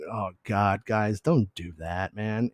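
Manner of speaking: soft, slow, weary groan of complaint; exasperated plea. Retold or imitated. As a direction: sigh it out, fed up. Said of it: "I'm like".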